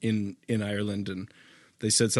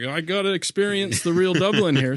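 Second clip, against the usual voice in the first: higher, louder eager voice; excited; 10 semitones higher, 9 dB louder than usual. Retold or imitated. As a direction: sound keen for adventure. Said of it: "I was like".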